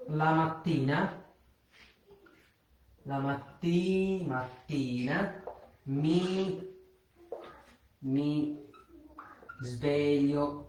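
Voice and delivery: la mattina mi sveglio, syllable by syllable, very slow; slow Italian demo. Shown as a demonstration. Teacher to Bangla students: slow careful Italian model. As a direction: spell out "mattina" and "sveglio" slowly for learners. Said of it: "এভাবে বলতে দেখেন".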